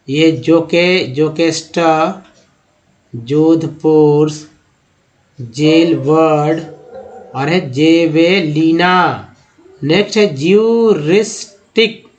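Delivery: ordinary delivery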